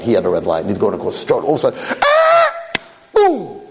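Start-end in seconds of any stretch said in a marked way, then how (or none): 2.0-2.5 sudden high shriek as the car hits; scream. Retold or imitated. Telling the boy's accident, a sharp shriek. 3.2-3.6 mock crash boom. Car hits the boy.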